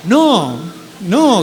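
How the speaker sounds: loud, high desperate cry of no; mother shouting. Acted. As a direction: shout no to stop the surgeon. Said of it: "grita mi mamá".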